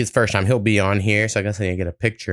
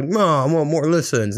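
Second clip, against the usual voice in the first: higher, whiny mock complaint; mock whining; 5 semitones higher than usual. Musician mimicking his own gripe about listens, mock whiny. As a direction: whine it like a petty grievance.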